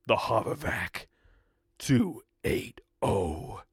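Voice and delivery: low, slow, drawn-out product name; slow cool ad voice. Shown as a demonstration. Host dramatically unveiling the lawnmower's name, slow and low. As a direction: savour the name like a movie trailer. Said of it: "That's good".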